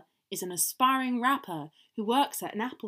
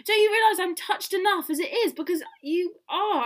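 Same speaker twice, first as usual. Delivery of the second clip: exasperated plea; 8 semitones higher than usual. Acted. Oliver tells upset Eve to calm down, exasperated.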